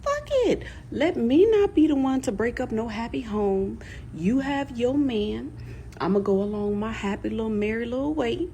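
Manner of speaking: sarcastic cheery farewell; sarcastic exit. Retold or imitated. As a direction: toss it off, mock-cheerful. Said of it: "So eventually I said".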